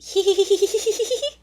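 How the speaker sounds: high cackling laugh in a strange voice; hyena cackle. A narrator's reading. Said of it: "Strange laughter rang out".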